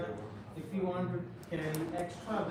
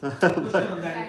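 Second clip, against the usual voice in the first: laughing; 11 dB louder, slower than usual. Real, not acted. Laughing after joke about porter.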